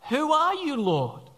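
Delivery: high startled question, dropping at the end; acted alarm from the ground; startled Saul. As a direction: blurt it high, then sink on the last word. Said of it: "Saul says".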